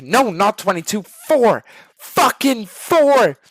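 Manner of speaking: rising to a high, loud, drawn-out yell; frustrated shout. Acted. Streamer correcting a stubborn teammate, in a frustrated shout. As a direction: explode on the last word.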